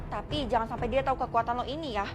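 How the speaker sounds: ordinary talk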